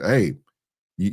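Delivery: normal talking voice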